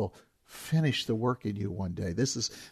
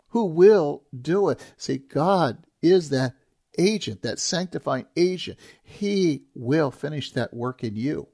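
preaching at full force; 3 semitones higher, 6 dB louder than usual. Acted. Pastor stressing God's faithfulness to the congregation, emphatic preaching. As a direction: drive home that God does the work.

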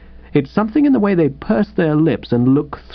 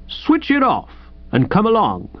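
london policeman; 7 semitones higher than usual. Acted. Policeman voiced by reader.

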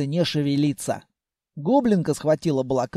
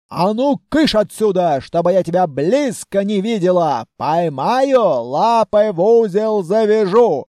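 raised-voice shooing threat; threatening scolding; 4 dB louder than usual. Acted. Grandmother drives the frightened throne away, threatening scolding. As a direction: shoo it off with an angry warning.

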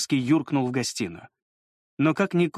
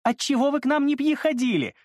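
put-on high, lisping girlish voice; lisping young lady; 12 semitones higher than usual. A narrator's reading. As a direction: chirp it like a giggly girl. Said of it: "разнообразно картавя, смеясь".

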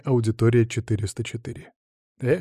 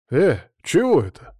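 higher, taken-aback question; startled gasp; 5 semitones higher, 4 dB louder than usual. A narrator's reading. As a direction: blurt it out in surprise. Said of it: "ахнул я".

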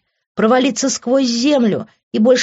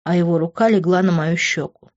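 plain reading voice

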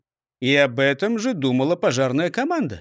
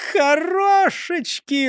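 high, slow happy exclaim; delighted; 12 semitones higher, much slower than usual. Acted. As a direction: savor the word with joy. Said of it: "радовался Густав Гейер". (радостный возглас)

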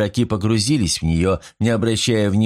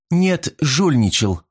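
higher, drawn-out accusing exclamation; exclaimed accusation; 6 semitones higher, slower than usual. A narrator's reading. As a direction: throw the accusation back, stretching it out. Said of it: "воскликнула мисс Чен".

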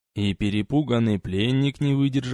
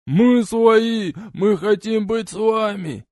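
high, loud desperate yelling; 11 semitones higher, 5 dB louder, slower than usual. A narrator's reading. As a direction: scream it while being beaten. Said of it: "Продолжали орать избитые".

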